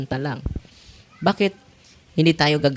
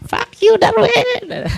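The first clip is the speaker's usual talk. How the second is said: high falsetto spat-out curse, louder; demon curse; 5 dB louder, much slower than usual. Retold or imitated. Priest imitating the demon's shouted curse, very high and loud. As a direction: hurl it in the demon's squeaky voice. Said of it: "demon cursing the priest".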